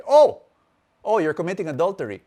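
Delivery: loud, high shocked "oh", then quick verdict; mock being scandalised by a confession; pretend disapproving response. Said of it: "What if I responded in this way".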